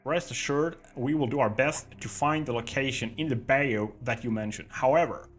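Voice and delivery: put-on deliberately terrible American accent; bad American accent. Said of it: "fake, bad American accent".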